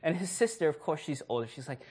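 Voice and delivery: usual speaking voice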